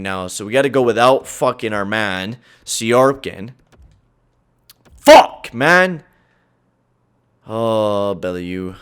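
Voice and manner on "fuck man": short high loud curse; angry shout. Real, not acted. Gamer cursing at his team's play, loud. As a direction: burst out in anger.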